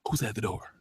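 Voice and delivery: barely audible whispered question; hushed whisper. Retold or imitated. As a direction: whisper it as if someone might hear. Acts out sudden knock in scene.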